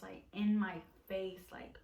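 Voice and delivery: ordinary talk, nothing marked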